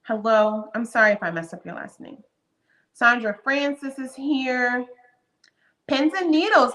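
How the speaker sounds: normal talking voice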